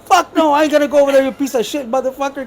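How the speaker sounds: high-pitched mock tirade; imitated angry rant. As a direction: curse out the boss as a retold outburst. Charlie curses boss, believing call ended.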